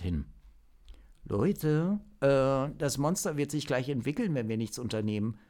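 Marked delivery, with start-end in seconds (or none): 1.2-5.4 pushing the team to act, quickening toward the end; a mild, hurried nudge, higher voice; urging. Acted. Zock urging his teammates before the monster evolves, hurried. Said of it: "drängte Zock".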